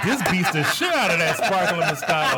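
giggling through the line; laughing. Retold or imitated. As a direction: laugh while quoting yourself. Laughing retold quote.

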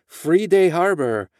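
thick Mexican accent, imitating mom. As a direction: say it like a Mexican mother. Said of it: "She's got a very thick Mexican accent".

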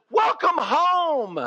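slow, high, loud cry of welcome; overjoyed sister calling welcome home; joyful welcome. Acted. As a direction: greet your brother back from the dead. Martha greeting her risen brother.

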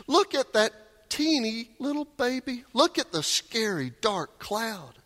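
very high, gushing amazement; childlike wonder. Retold or imitated. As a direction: squeal in delight at everything. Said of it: "you start going".

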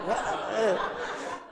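soft, breathy laugh; laughter. Real, not acted. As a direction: laugh at your own joke. Laughs at own caricature.

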